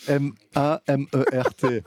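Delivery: each letter of "M A M E R T" said apart; spelled out letter by letter. Shown as a demonstration. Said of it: "pas ma mère". (épeler "M A M E R T" lettre par lettre)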